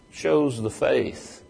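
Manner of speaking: ordinary talk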